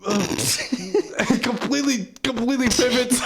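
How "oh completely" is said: usual speaking voice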